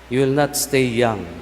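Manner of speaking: normal talking voice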